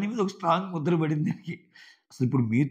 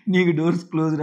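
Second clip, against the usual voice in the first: raised, louder, dismissive quote; half-acted loud dismissal; mock blunt retort; 4 semitones higher, 7 dB louder than usual. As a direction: snap it back at her. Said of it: "అన్నట్టు అంటది దివ్య".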